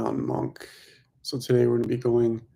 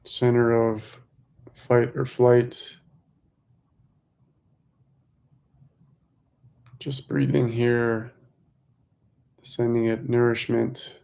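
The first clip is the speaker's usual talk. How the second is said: measured mantra pacing, leaving room to breathe; guided meditation. Real, not acted. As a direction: lead them gently through the breath, stretching key words.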